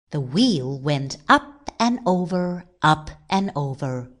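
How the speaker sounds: ordinary delivery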